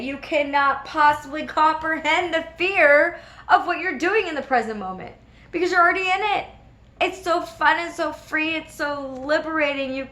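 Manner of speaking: high-pitched, swelling motivational rush; impassioned enthusiasm. Real, not acted. Speaker urging viewers into the present moment, impassioned enthusiasm. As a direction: carry the listener away with the thrill.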